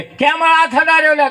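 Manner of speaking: the guru scolds his disciple sharply; angry guru. Retold or imitated.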